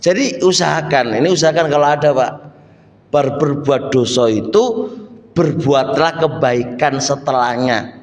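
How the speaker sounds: ordinary talk, nothing marked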